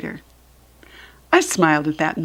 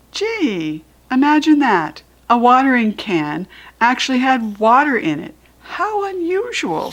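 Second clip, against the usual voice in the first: rising, mocking sarcasm; dry fake amazement; sarcastic; 4 semitones higher than usual. Mother being sarcastic with Junie B about the watering can. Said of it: "That is called sarcastic".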